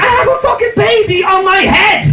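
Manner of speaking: high-pitched comic outrage; indignant outburst. Retold or imitated. Wrongly suspected dad protesting, high indignant acted outburst. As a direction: protest in hammed-up disbelief, voice shooting up.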